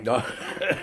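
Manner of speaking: a brief amused laugh; short laugh. Acted. Professor amused that Holmes has smoked enough, short laugh. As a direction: laugh off the remark.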